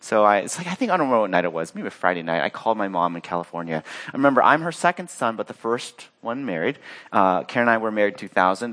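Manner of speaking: ordinary talk, nothing marked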